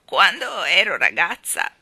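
put-on loud, slow, quavering old balloon seller; trembling old woman. Balloon woman chides the children, in a loud trembling old voice. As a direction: scold them like a frail old woman full of passion.

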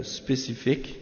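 usual speaking voice

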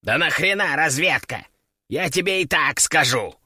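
irritated, grumbling parrot voice. A narrator's reading. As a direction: grumble it like a ruffled bird. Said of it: "проворчал он".

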